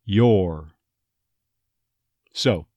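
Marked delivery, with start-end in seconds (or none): 0.0-0.7 "your" said as a full "yore"; full, careful "your". Shown as a demonstration. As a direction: give "your" its full vowel and R. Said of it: "rather than".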